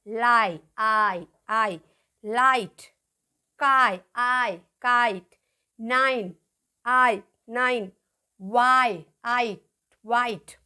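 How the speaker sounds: "लाइट" with a full, held आई; long I demo. Shown as a demonstration. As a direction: stretch आई in "नाइन", each piece separate. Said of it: "आई की sound".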